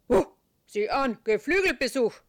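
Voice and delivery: loud gruff terrier; barking dog voice. A narrator's reading. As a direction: bark the words like a dog. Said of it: "bellt Charlie".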